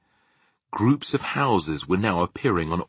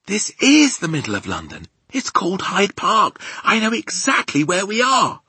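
put-on high, loud little-girl insistence; insistent; 13 semitones higher, 6 dB louder than usual. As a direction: correct the giant urgently, pitch climbing. Sophie insists she knows the place.

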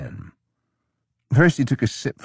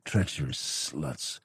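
muttered; 5 semitones lower, 10 dB quieter, slower than usual. A narrator's reading. Drunk muttering on the floor.

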